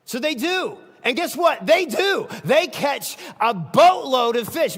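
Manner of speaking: high, loud, driving sermon voice; fiery preacher, climbing and loud; preaching at full force. Acted. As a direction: build the story up to a shout.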